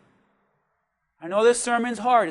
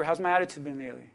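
quiet question; 8 semitones lower, 10 dB quieter, much faster than usual. Shown as a demonstration. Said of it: "Ask your neighbor".

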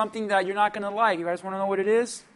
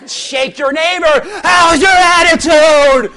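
loud, slow shouted call to the congregation; full-force preaching shout; preacher's shout; 5 semitones higher, 10 dB louder, slower than usual. Real, not acted. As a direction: rouse the room and bellow the question. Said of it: "say it with me".

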